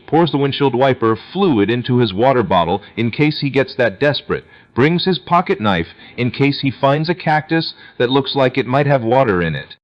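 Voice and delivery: ordinary delivery, nothing marked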